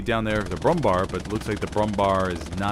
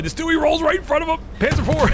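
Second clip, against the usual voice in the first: high, loud excited play call; 5 dB louder than usual. Real, not acted. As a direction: shout the hit out in delight. Excited caster calling a hit.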